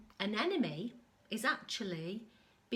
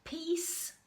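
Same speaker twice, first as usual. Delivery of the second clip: crying out for peace; 7 semitones higher than usual. Acted. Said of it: "cries Brush".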